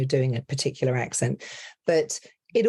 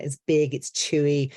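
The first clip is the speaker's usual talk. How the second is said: normal talking voice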